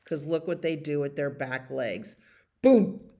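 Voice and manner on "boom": loud, high "boom"; sudden punchy sound effect; imitated kick impact. Acts out donkey's kick.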